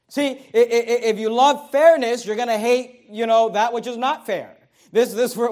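ordinary talk

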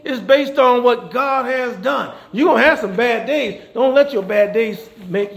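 loud, high preaching climax; preaching at full force. Acted. Preacher rousing the congregation, loud and high. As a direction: lift it up and proclaim.

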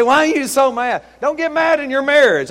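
high, loud mock scolding; joking holler. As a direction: pretend to yell at someone in the pews. Joking yell at lady in congregation.

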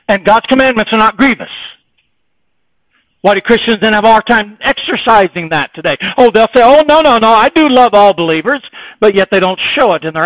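loud, high, driving sermon delivery; preaching at full force. Acted. Preacher rousing his church about loving all believers, loud and fervent. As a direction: hammer every line home to the congregation.

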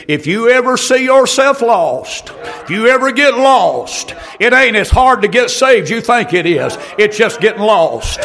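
rising, shouted sermon cadence with punched words; full-force preaching. Real, not acted. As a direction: preach it hard to the congregation. Preacher climbs into exhortation.

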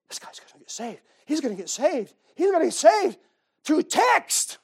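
starts whispered, rises to a high, loud thrilled cry; building excitement. Retold or imitated. Preacher retelling his thrill leading a man to Christ, building excitement. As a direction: whisper it then build to a joyful shout. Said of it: "I was getting so excited".

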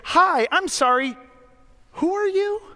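polite, kindly host rising high on who are you. Retold or imitated. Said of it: "look at me very kindly and say".

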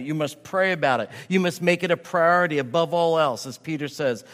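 ordinary talk, nothing marked

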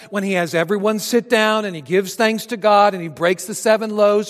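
high, driving sermon delivery; forceful preaching. Real, not acted.

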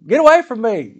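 high, loud mock toddler protest; protesting child. Toddler pushing her father away, imitated loud protest. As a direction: imitate a small child shoving someone off. Acts out daughter rejecting him.

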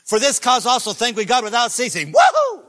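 loud reading ending in a high whoop; excited verse read. Acted. Preacher whooping over the verse for the congregation, excited. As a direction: read it then whoop with joy.

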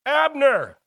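high, loud holler across the camp; a full-voiced holler. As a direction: call out to a man far off, loud and high. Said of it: "he hollers back".

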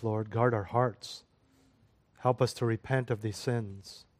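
low-key, softened pastoral prayer. Real, not acted. Leads closing prayer.

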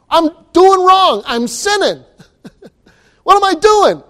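mock alarmed worrier. Preacher playing a worrier caught in the act, high and laughing. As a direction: play a startled sinner catching himself.

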